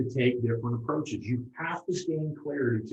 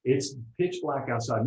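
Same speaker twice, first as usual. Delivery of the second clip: ordinary delivery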